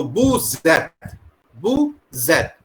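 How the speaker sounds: "vous êtes" with the S carried onto the E as Z; linking Z sound. Shown as a demonstration. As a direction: link "vous êtes" into voo-zet, twice. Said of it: "S sound is the overlap on E". ("vous êtes" में S की Z आवाज़ E से जुड़ी)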